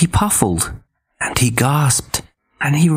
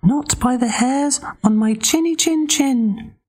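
very high squeaky pig; tiny pig defiant; little pig voice. Acted. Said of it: "he replied".

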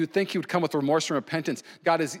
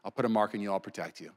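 merciful God; 6 semitones lower, 6 dB quieter than usual. Retold or imitated. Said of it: "He says".

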